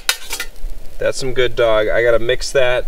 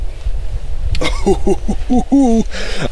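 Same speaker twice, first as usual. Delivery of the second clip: high-pitched giggle; high laugh; 11 semitones higher than usual. Real, not acted. Laughing before eating.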